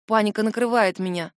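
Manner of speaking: plain reading voice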